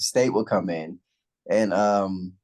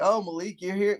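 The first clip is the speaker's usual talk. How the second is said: surprised coworker; 9 semitones higher than usual. Retold or imitated. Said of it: "They're like".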